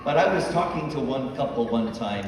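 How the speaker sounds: ordinary talk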